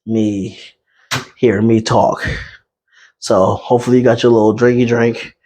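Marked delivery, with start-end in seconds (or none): none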